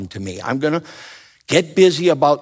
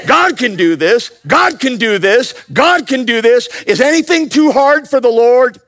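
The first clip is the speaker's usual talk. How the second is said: loud, high preacher's cry with more throat; 13 semitones higher, 7 dB louder than usual. Shown as a demonstration. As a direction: say it like a fired-up preacher. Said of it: "say it like you're a preacher with a little more in your throat".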